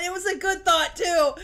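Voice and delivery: loud, high, laughing lament. Real, not acted. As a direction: protest through real laughter. Laughs over losing her thought.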